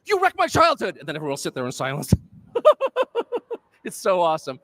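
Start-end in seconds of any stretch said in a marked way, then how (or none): 0.0-1.0 sudden high, loud outburst at dinner; blurted outburst. Retold or imitated. Drunk relative at Christmas dinner blurting out a sudden loud accusation. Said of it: "someone will blurt out". 2.2-3.6 high, loud burst of laughter; big laugh. Real, not acted. Speaker laughing at his own Christmas dinner story, loud burst of laughter.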